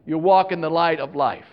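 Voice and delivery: usual speaking voice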